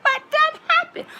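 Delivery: shocked bystander. Retold or imitated. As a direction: yelp it out, totally bewildered. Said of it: "You like".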